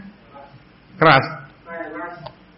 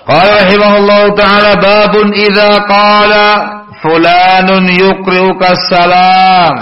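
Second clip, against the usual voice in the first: bacaan kitab; 8 semitones higher, 4 dB louder, slower than usual. Acted. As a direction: bacakan judul bab kitab seperti melantunkan.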